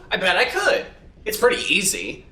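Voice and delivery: ordinary talk, nothing marked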